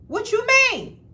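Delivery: high, loud incredulous challenge; indignant what. Retold or imitated. Woman retelling confronting her ex, high indignant disbelief. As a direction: fire it back at him in disbelief.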